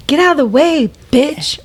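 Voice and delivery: mock griping at a bad driver; mild unhinged road rage. Mom acting out her old road rage in the car, mildly angry. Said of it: "this is me in a car. So unhinged".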